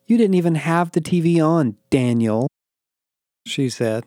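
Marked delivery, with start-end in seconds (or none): 0.1-2.5 high protest, then Daniel set apart with emphasis; stress on Daniel. A narrator's reading. Becky arguing over the TV, emphasizing "Daniel". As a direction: lean on "Daniel" as if it proves the point. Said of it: "emphasizing my name as if it validated her point".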